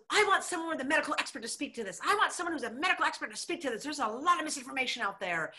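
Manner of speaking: mock high, fast official voice; mocking the chair. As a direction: parody her as shrill and hurried. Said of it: "she goes".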